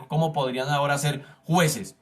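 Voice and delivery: ordinary delivery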